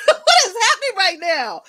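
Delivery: high, loud, laughing disbelief; mock-alarmed manager, loud and high. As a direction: blurt it out through a laugh, shocked. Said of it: "she was like".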